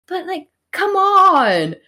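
high, drawn-out protest; exasperated. Real, not acted. Co-host objects to Margaret's snappiness, exasperated. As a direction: stretch it out in loud disbelief.

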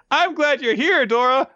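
very high, earnest mock voice; hammed-up high sentimental call; gushing first character. Kusanagi tells Dora he is glad, high and gushing. Two characters trade same line.

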